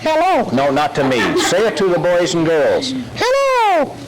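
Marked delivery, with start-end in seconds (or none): 0.0-0.5 high puppet hello; little squeaky greeting. Acted. Dummy greets the officer, high puppet voice. 3.2-4.0 very high sing-song puppet hello; sweeter, higher squeak. Acted. Dummy greets the boys and girls, very high puppet voice.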